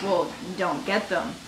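low, quiet brush-off; deadpan dismissal. Retold or imitated. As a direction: drop it low and soft, unimpressed. Dismissive reply to date's complaint.

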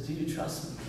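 soft, hushed question from God. Retold or imitated. God quietly asking the pastor if he trusts him, hushed. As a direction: let God ask it gently, almost under the breath.

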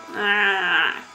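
ordinary talk

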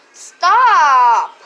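loud shout. Pretend. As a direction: yell stop. Toy shouts.

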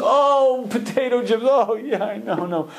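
high-pitched, whiny, lightly laughing dieter; high whiny woman. Retold or imitated. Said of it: "Another one goes".

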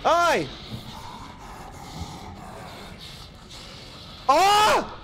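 high startled cry of hey then ah; startled yell. Real, not acted. Gamer caught off guard by an enemy, startled high yell. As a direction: jump at the sudden attack, high and sharp. Sudden attack in game.

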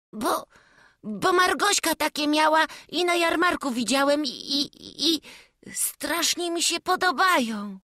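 put-on high, loud, stumbling child; a small boy tripping over his words; stammering excited boy. A narrator's reading. Chłopiec jąkając się tłumaczy królewiczowi, wysoko i głośno.

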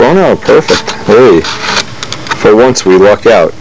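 ordinary talk, nothing marked

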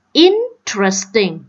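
"Interesting" slowly, stressed on IN; first-syllable stress. Shown as a demonstration. Teacher showing word stress to students, first-syllable stress. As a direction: punch IN, then "tresting" in "Interesting". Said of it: "nhấn âm thứ nhất luôn".

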